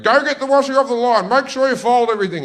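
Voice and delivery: loud, high, fast shouted order; mother's yell. Retold or imitated. Mother in the kitchen ordering her boys about the washing, yelling. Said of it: "yelling at us while we were doing it".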